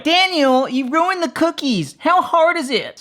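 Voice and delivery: high-pitched exasperated Welsh mother; hammed-up Welsh mum telling off; Welsh accent mum. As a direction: scold a child in a high, singsong Welsh accent. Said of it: "that's my best Welsh accent".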